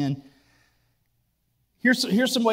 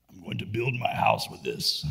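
low, soft lightly voiced barbarian; a hint of a dim barbarian; 9 semitones lower, 6 dB quieter than usual. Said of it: "I don't know if that's the barbarian voice".

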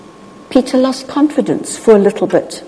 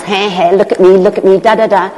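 playful sing-song boasting, lightly voiced; a hint of gleeful showing off; mock show-off; 4 dB louder, faster than usual. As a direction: show off like a kid wanting attention. Mimics a show-off dancing on water.

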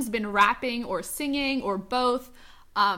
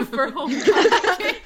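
laughing; 5 semitones higher, 8 dB louder, slower than usual. Real, not acted. Laughing at Family Guy plot.

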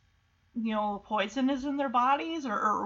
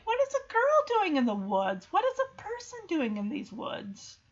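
acted bafflement; 6 semitones higher than usual. Acts out astonishment at finding girl.

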